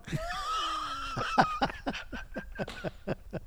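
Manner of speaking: high, wheezy laughing fit; long helpless laugh. Real, not acted. Storyteller tickled by the girl's remark, laughing helplessly. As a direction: crack up at your own story.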